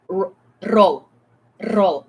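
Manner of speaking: demonstrating a rolled R; rolled R demo. Shown as a demonstration. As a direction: roll the R in roll with a tongue trill. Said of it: "so when you say th".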